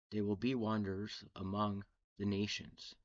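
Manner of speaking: ordinary delivery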